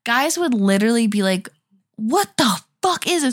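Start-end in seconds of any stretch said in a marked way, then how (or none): 2.0-3.3 high-pitched mock outrage; freaking-out guy. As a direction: act out a guy freaking out, hammed up. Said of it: "then they start crying".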